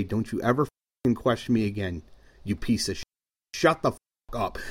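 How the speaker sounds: low, hushed threat close to his ear; quiet threat. Pretend. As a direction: menace him softly so nobody else hears. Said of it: "if you don't wanna make a scene".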